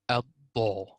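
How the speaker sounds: "ad-da" with a full dark alif, "ad-daw"; heavy alif. Shown as a demonstration. Tutor to student, showing the heavy alif. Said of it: "alif itself will be magnified".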